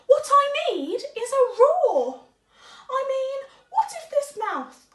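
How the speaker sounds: a tiny mouse bursting with his big idea; excited mouse. Acted. Mouse announcing his plan to roar. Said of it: "he said".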